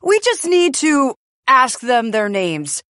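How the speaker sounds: self-assured storybook character; confident. A narrator's reading. J P tells the young birds the answer, confidently. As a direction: state it with easy confidence. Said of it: "J P says confidently".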